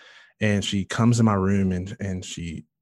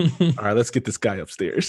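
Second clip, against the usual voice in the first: doctor voice; 2 semitones higher than usual. Retold or imitated.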